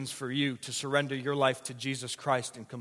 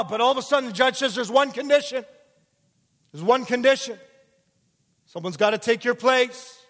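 much higher, louder shouted preaching; fiery preaching at climax; 10 semitones higher, 10 dB louder than usual. Acted. Preacher voicing the judge's condition, at full force. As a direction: shout it over the erupting courtroom.

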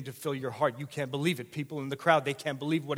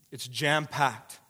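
ordinary talk, nothing marked

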